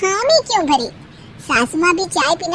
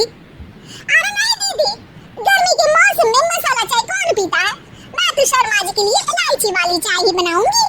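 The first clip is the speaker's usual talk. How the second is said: middle daughter-in-law; 9 semitones higher than usual. Acted. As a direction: wave off her sister-in-law's idea.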